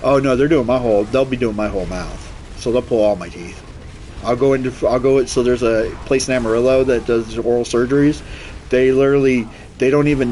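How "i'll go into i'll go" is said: ordinary talk, nothing marked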